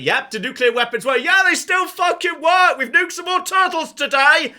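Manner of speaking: loud, high whooping boast; gleeful shouting. Acted. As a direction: crow about it at full volume. Acting gleeful nuke testers.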